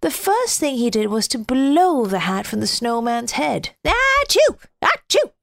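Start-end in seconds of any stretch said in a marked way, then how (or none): 3.8-5.3 two loud high sneezes; big comic sneezes; snowman sneezes. A narrator's reading. Snowman hit by the north wind, loud high sneezes. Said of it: "sneezed the snowman".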